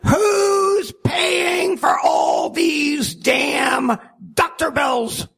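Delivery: put-on high, slow old mother's cry. A narrator's reading. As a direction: wail it like an angry old mother. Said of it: "Mother Sunshine cries out".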